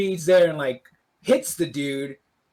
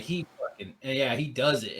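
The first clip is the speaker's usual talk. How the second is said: usual speaking voice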